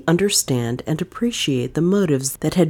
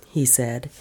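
ordinary delivery, nothing marked

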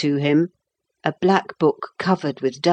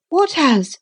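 put-on high-pitched boy's question; puzzled boy; 11 semitones higher, 3 dB louder than usual. A narrator's reading. Boy to the captain, puzzled. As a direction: ask as a puzzled small boy.

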